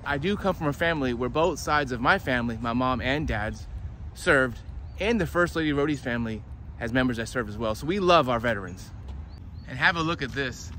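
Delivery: normal talking voice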